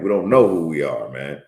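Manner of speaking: ordinary talk, nothing marked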